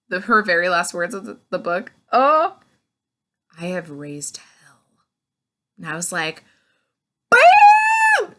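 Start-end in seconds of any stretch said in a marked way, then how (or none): 2.1-4.8 villainous whisper. Acted. Villain's last words in book. 7.3-8.4 very high, loud burst of delight; excited shout. Retold or imitated. Excited reaction to ending.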